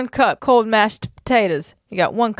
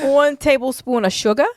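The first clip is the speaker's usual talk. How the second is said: higher put-on Midwest accent; mock Midwest accent; 5 semitones higher than usual. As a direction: overdo a full Midwest accent for laughs. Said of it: "it's full Midwest".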